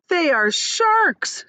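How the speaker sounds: slow, high dread; fearful. Acted. Earthworm naming the sharks, slow and high with dread. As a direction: let it sink in with fear. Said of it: "said the earthworm".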